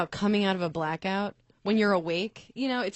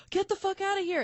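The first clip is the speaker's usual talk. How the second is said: much higher, shrill-free yelled-style retort at a stranger; retold outburst; 12 semitones higher than usual. Waking mid-blackout, ordering the stranger out, much higher. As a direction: toss him out, voice shooting way up. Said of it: "I'm like".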